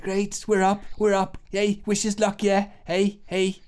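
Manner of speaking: pumped up before going on stage; eager band member. Acted. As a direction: band member heading out to play. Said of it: "and he's like".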